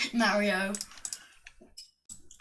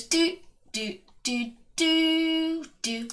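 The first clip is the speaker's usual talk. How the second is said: high little "doo" beeps; sung shrinking noise; 5 semitones higher, 4 dB quieter, slower than usual. Acted. Narrator mimics Mario shrinking to fit, high little beeps. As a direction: imitate a game power-down in rising doo notes. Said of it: "So he goes".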